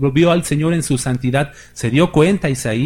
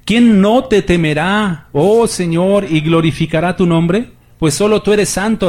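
fervent scripture reading; 5 semitones higher, 4 dB louder than usual. Acted. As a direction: proclaim it to the congregation like a psalm. Reads scripture with fervour. (lectura solemne y pausada, con voz elevada)

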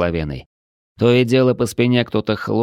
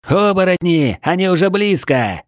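high-pitched warning shout; alarm cry; 11 semitones higher, 4 dB louder than usual. A narrator's reading. Watchmen on the wall warn those below, high alarmed shout. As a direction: cry the danger down from above. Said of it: "неслось со стены".

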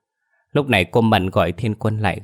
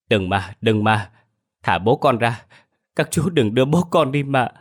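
put-on panicked child's cry; 8 semitones higher than usual. A narrator's reading. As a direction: scream and plead so they won't take father. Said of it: "miệng hốt hoảng hét toáng lên". (đứa trẻ hoảng hốt hét toáng lên van xin)

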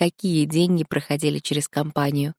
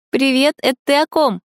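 put-on much higher, chirpy girlfriend voice; bright friend voice; 8 semitones higher, 4 dB louder than usual. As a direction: pop in curious and high. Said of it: "раздался позади голос Тани".

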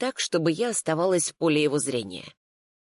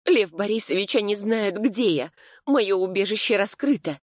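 higher, urgent pleading voice; alarmed protest; 5 semitones higher than usual. A narrator's reading. Client tells lawyer her hideout is exposed, alarmed. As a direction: press him with rising alarm, pitched up.

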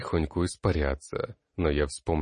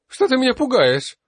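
much higher, loud falsetto shriek; falsetto cry; 11 dB louder than usual. A narrator's reading. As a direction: yelp it in falsetto. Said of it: "фальцетом вскрикнул тот". (вскрик фальцетом, громко и очень высоко)

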